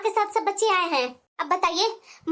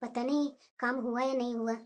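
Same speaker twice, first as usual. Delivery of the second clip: much softer, lower murmur to herself; worried aside; 7 semitones lower, 11 dB quieter than usual. Acted. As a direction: wait anxiously alone for daughter-in-law. (धीमी चिंतित आवाज़ में)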